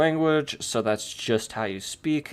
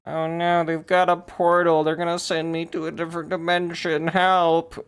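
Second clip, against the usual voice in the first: higher-pitched pretend alarm; mock panic; 6 semitones higher than usual. Potential victim of the portal pleads, in mock panic. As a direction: play at being alarmed and calling for help. Acted cry for help about portal.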